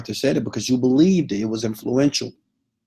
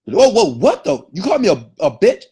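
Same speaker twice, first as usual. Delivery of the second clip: higher, louder indignant disbelief; acted offense; 6 semitones higher, 5 dB louder than usual. Insulted person challenging the name-caller, acted indignant offense. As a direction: react sharply, as if just insulted.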